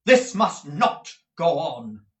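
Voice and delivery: firm loud declaration. Acted. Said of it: "said Nicholas, stepping forward".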